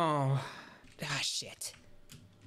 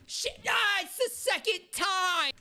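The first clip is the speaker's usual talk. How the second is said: frustrated high shout; slower than usual. Real, not acted. Streamer yelling as he fails the same jump twice. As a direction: howl at failing twice in a row.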